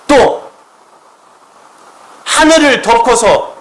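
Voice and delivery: loud, high-pitched impassioned preaching; full-force preaching. Acted. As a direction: build it up and proclaim it with full force. (높고 크게 외치는 설교)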